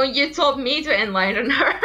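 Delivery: ordinary talk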